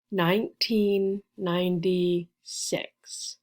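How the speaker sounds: "nineteen ninety-six" slowly, with gaps between the words; slow and careful. Shown as a demonstration. Teacher gives birth year, slowly and carefully. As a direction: space out each word for learners.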